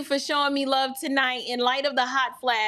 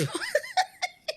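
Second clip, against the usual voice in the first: helpless high giggling; high-pitched laugh; 4 dB quieter than usual. Real, not acted. Laughs at Grace's teasing.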